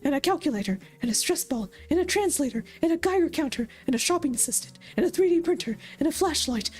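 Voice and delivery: a smug plushie gadget bragging to a rival toy; chipper robot toy. Acted. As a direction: pitch it up like a proud little toy. GM voices Plushie Pet.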